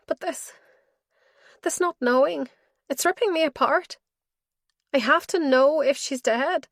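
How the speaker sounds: a daughter at her father's bedside, pleading through tears; tearful plea. Acted. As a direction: beg him to answer, voice straining.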